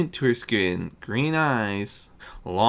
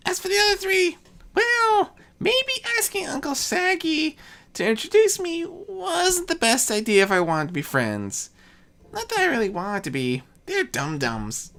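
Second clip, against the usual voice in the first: Discord as a boastful youth. Acted. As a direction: brag it like a mischievous young god. Discord's voice, held.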